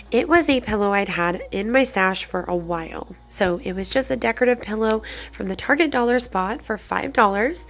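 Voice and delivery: ordinary talk, nothing marked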